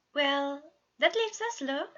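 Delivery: bright fatherly lilt up high; high upbeat dad voice observing; cheerful dad voice. A narrator's reading. Patton voiced, higher.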